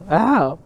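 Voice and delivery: loud faked yelp of pain; fake ow. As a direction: pretend you got poked in the eye. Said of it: "I fooled you".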